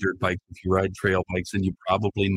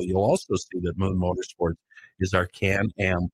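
ordinary delivery

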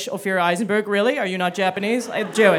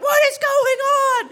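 slow, very high mock scream; high drawn-out wail of alarm; mother's alarmed shriek; slower than usual. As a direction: imitate a frantic mom, stretching each word. Mother amid chaos.

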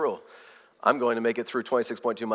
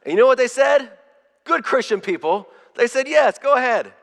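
much higher and louder, thrilled punchline; excited; 10 semitones higher, 5 dB louder than usual. Real, not acted. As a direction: lift it up like great news. Excited retelling of kind strangers.

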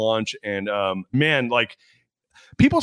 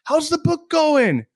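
mock friendly family; 3 dB louder, much slower than usual. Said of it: "they're like".

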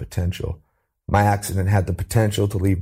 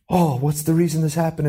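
a sharp gasp, then a bright, much higher "oh"; hopeful gasp; 8 semitones higher than usual. Retold or imitated. Imitating people who turn tragedy into purpose, eager and high. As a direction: mimic someone seizing on the idea eagerly. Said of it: "some people take that and".

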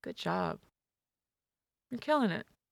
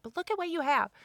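higher, scolding mock voice; mock critic; 11 semitones higher, 4 dB louder than usual. Guest imitating critics who scold her ambition, higher and snippy. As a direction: throw it at her like a smug critic. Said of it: "come at me and say".

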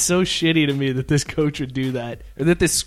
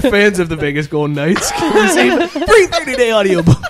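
announcer ad read; 4 semitones higher, 7 dB louder than usual. Retold or imitated. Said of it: "And he does the whole plug".

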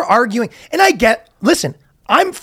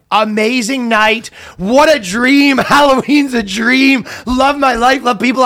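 mock gushing; 3 semitones higher than usual. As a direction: parody a blissful social media post. Mocking gushy social media post.

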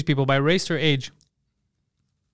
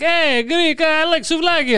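high-pitched mock car-guy schmoozing; pandering car salesman; 10 semitones higher than usual. Car guy pandering to a Greek customer, mock schmoozing. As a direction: put on a much higher, hammed-up salesman's greeting. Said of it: "watching a car guy, depending if there's a Greek guy".

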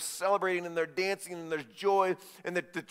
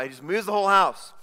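loud, raised-pitch exclamation; emphatic amazement; 3 semitones higher, 7 dB louder than usual. A narrator's reading. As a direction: repeat it big, marvelling at the feat. Emphatic repeat of the miracle.

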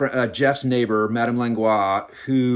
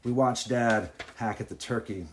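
usual speaking voice

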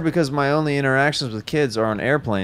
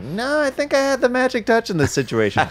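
self-quote; 13 semitones higher than usual. Retold or imitated. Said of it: "And I'm like".

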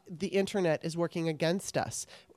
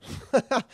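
a short higher chuckle; real laugh; 6 semitones higher than usual. Real, not acted. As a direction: laugh at your own line. Host laughs after naming guest.

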